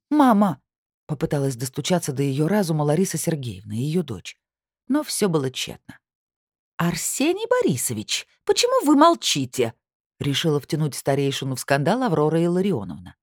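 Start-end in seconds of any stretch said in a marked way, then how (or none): 0.1-0.6 loud pleading cry; pleading call. Acted. Daughter pleads with her mother. 6.8-9.7 high, shrill, indignant demand; shrill outraged appeal; shrill indignant woman. Acted. Aurora drags elder in.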